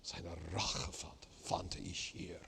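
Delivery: slow, soft half-whispered text; frustrated murmur. Shown as a demonstration. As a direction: speak it like a trapped man, under breath. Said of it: "It's, it's frustrating".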